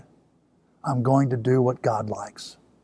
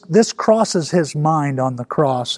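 ordinary talk

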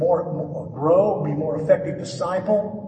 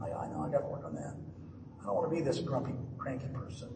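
sheepish admission; 2 semitones lower, 14 dB quieter, much faster than usual. Retold or imitated. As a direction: concede it under the breath. Sheepish admission after wife's remark.

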